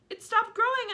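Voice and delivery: high, loud cry; excited aunt cry. Acted. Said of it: "Aunt Spiker cried".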